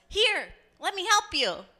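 high, friendly call across the water; helpful sailor. Acted. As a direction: call out an offer of help. Voicing sailor calling to drowning man.

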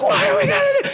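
very high mock shriek; panicking mom. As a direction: imitate a mother in hysterics. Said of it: "My mom's like".

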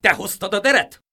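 high, loud accusing shout. A narrator's reading. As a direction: accuse him at the door. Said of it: "kiáltotta az egyik".

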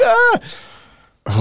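high-pitched imitated yelp of pain; mock pain cry. Friend reacting as medics reset his ankle, mock pain cry. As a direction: yelp like someone having a bone reset.

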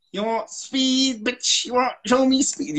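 high, drawn-out cartoon taunt; mock tough challenge. Teacher jokingly challenges co-host on speed, high taunt. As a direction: dare them in a high put-on voice. Joking movie-style challenge about speaking fast.

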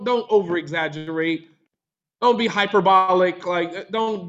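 usual speaking voice

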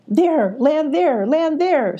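slow, high goat pointing out the spot; urging goat. A narrator's reading. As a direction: steer him to the landing. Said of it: "suggested Billy to Radar".

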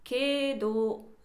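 けど drawn out slowly to show its e-o vowels; slow, careful けど for a vowel lesson; vowel demo. Shown as a demonstration. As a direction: stretch けど so the え and お ring. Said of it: "母音が同じじゃないですか".

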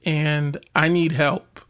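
ordinary talk, nothing marked

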